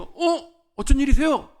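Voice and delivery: high-pitched, delighted greeting; glad surprise. Retold or imitated. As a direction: greet an unexpected acquaintance, voice leaping up. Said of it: "왠지 모르게 반갑더라고요".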